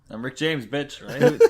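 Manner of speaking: [name] impression. Famous [name] catchphrase quoted.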